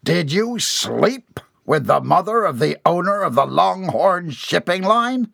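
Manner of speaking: high, building angry question; incredulous fury. A narrator's reading. Boss asking about the affair, incredulous fury. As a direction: climb in outrage towards the last word. Boss's face reddens, demands answer.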